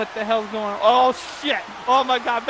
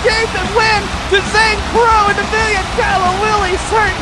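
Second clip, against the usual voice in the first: loud, high-pitched play-by-play; excited recap; 7 semitones higher, 7 dB louder than usual. Real, not acted. Commentator recaps the shock title win, excited. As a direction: shout the recap over a roaring crowd.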